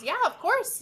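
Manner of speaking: eager servant. Acted. Servant voiced, high and bright.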